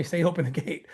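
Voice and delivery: quiet inner voice. Retold or imitated. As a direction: hear it as a soft inner prompting. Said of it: "the Holy Ghost was telling me".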